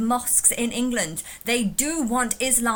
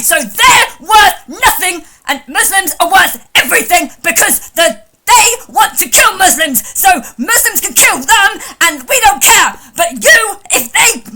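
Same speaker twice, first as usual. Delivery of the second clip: shrill mocking rant, higher and much louder; mocking his ranting; 6 semitones higher, 14 dB louder than usual. Mocking the other caller's rant, screaming parody. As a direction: channel his furious energy in a screeching parody. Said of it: "I can channel his energy".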